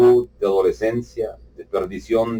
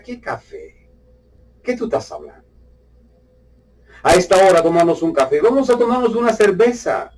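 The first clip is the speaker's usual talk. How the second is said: mocking scoff; 8 semitones higher than usual. Mocking the coffee invitation.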